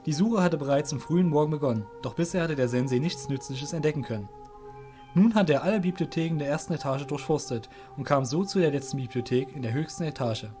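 plain reading voice

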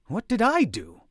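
high, loud whining protest; whine. A narrator's reading. Scolded elder whining in protest. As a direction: plead your innocence like a child. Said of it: "Borivad whined".

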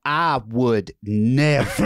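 slow, drawn-out offended denial; mock indignant denial. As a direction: clutch your pearls, drag it out. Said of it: "I was like".

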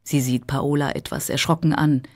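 ordinary delivery, nothing marked

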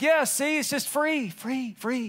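high, airy mock-carefree voice; sloppy agape believer. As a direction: float it up high, lightly dismissive of rules. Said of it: "if you're all into the sloppy agape part".

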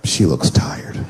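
low hushed whisper; whispered thought. Retold or imitated. Husband noticing his tired wife at night. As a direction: breathe it under your breath. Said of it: "you're like".

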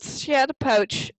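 plain reading voice

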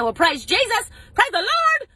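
high, loud church-style hallelujah; mock praise. Wife hearing she has phone insurance, high loud mock praise. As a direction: shout thanks to heaven, hammed up.

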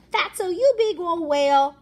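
high, loud sneering bully voice; bully taunt. Acted. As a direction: sneer it at him like a schoolyard bully.

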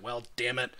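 low, quiet grumble; muttered to herself. Acted. Jaya wiping off bark and mud, muttering to herself. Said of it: "being uttered to herself".

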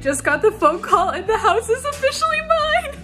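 choking up with joy while laughing; tearful laughter. Real, not acted. Tearful happy laugh announcing she now owns the house.

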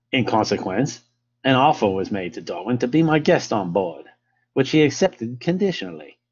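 hammed-up amateur English accent, lilting. Lecturer reading Fitzroy's account to listeners, in an English accent. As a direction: read it out in your best English accent. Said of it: "best English accent".

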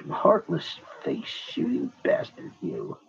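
hissed joking insult; soft teasing whisper; mock whisper.

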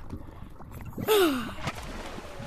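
S=ugh; loud frustrated groan. Real, not acted. Frustrated at the game.

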